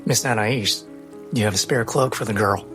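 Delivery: ordinary talk, nothing marked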